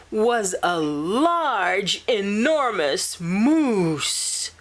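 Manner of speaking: slow, stretched "large, enormous moose"; storyteller stretching "enormous"; drawn-out emphasis. A narrator's reading. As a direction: draw out every word to make the moose huge. Reading emphasis on big moose.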